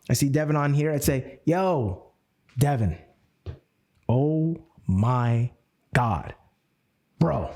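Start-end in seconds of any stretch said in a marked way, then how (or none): 1.4-7.5 drawn out, word by word with long pauses; slow pattern interrupt. Shown as a demonstration. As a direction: stretch each word and let it hang. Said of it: "called you up and I was like".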